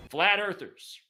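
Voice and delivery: ordinary talk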